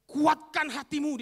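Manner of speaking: loud, high, drawn-out command; loud exhortation. Retold or imitated. Disciples calling the blind man to take heart, loud exhortation. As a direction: stretch it out forcefully. Disciples' startling call to Bartimeus.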